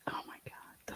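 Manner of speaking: shocked breathless whisper. Real, not acted. Streamer reading shocking evidence, whispered. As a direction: whisper it in disbelief.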